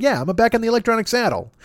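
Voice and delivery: high, mock-proud declaration; mock triumphant. Real, not acted. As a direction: announce it with mock pride.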